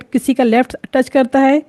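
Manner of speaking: usual speaking voice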